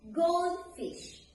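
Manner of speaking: "goldfish" with GOLD pitched high, fish falling; stress on first noun. Shown as a demonstration. Teacher showing how we pronounce it, stress on GOLD in "goldfish". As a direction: put the weight on "gold" in "goldfish". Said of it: "So we pronounce it as".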